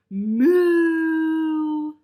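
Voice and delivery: loud, very slow, high mooing puppy; long drawn moo. A narrator's reading. Puppy George mooing at his mother, long and loud. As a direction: stretch the moo out like a calf.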